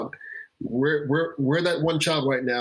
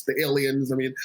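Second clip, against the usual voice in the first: ordinary talk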